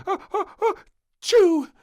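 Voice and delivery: rising ah-ah-ah, then a loud choo; the general builds up to a loud sneeze; built-up sneeze. A narrator's reading. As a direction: wind up and explode into a sneeze. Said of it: "sneezed the general".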